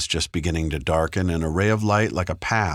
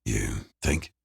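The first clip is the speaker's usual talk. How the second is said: softer, a slight hesitation; hesitant; 6 semitones lower, 5 dB quieter than usual. A narrator's reading. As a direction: hold back for a beat, unsure. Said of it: "the slightest hesitation in his voice".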